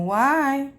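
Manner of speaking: usual speaking voice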